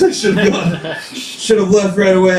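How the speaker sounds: normal talking voice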